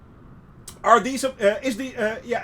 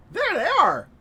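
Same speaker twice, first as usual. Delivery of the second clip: excited; 10 semitones higher than usual. Real, not acted. Spotting hippos excitedly.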